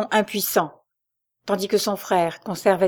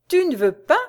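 higher, louder shocked cry; exclaiming in disbelief to his brother; indignant outcry; 7 semitones higher, 5 dB louder than usual. Acted. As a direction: throw it back at him in outrage. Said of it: "s'écria Albéric".

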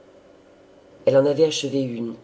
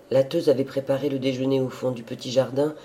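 usual speaking voice

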